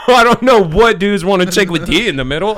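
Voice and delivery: laughing tease. Real, not acted. Teasing reply, laughing.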